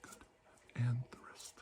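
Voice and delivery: soft unvoiced whisper. Doctor whispering to the patient during an exam, whisper. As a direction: breathe the words to the patient.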